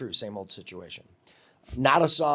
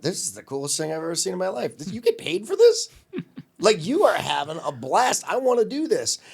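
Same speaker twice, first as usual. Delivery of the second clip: high, excited gushing; retold amazed excitement; 7 semitones higher, 4 dB louder than usual. Teen intern watching a DJ do his live show, amazed and excited. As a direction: act out a thrilled teen, pitch leaping up.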